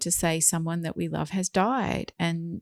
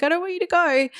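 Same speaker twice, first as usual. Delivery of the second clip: put-on high, loud pleading kid voice; whiny child; 12 semitones higher, 5 dB louder than usual. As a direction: plead like an upset little kid. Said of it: "the kids might be like".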